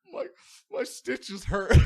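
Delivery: loud, high, drawn-out sobby whine; hammed-up tearful excuse; mock crying. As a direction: whimper it like a crying child. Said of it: "crying my eyes out".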